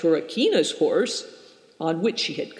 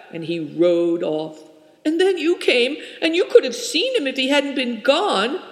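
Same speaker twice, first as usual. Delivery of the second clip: high, tense, breathless telling; a frightened girl blurting out what happened; upset young girl; 8 semitones higher, 5 dB louder than usual. A narrator's reading. Turakina's long upset account.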